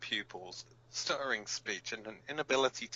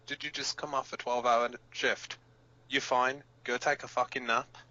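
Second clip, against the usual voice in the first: blunt, louder scolding; exasperated; 2 semitones higher, 8 dB louder than usual. Acted. [name] to panicking members, exasperated scolding. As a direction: tell them off, fed up.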